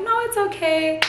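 high, forced polite reply; fake politeness. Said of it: "I was like".

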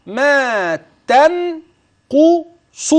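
ما تنقص very slow, each letter pulled apart; split letter by letter. Shown as a demonstration. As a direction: spell تنقص out sound by sound. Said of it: "ليه قسمت الكلمة دي حروف؟". (تقطيع تنقص حرفا حرفا)

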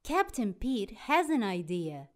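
usual speaking voice

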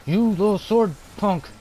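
high, loud furious insult; angry yelling. Real, not acted. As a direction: yell the insult in fury. Angry at enemy player.

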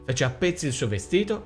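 plain reading voice

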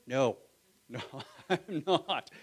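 laughing through a sheepish no; a laughing, cheerful confession. Acted. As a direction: admit it with a laugh. Laughing admission of doubt.

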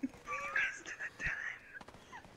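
breathy high whisper. Pretend. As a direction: whisper it secretly. Whispered joke.

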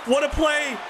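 high, loud what a play; excited shout. Real, not acted. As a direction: burst out at a great play. Great play on screen.

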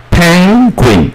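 "Penguin" said high, each syllable spelled out clearly; careful pronunciation. Shown as a demonstration. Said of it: "P E N就念pen". (高音清楚念出"Penguin")

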